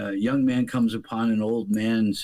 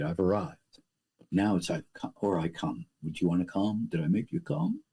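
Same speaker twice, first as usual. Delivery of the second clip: mock young lover; 6 semitones lower, 6 dB quieter than usual. Speaker mocks a young man in bed, low and soft. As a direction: lower and softer, mocking an insecure young man. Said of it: "I know all the problems of younger boys".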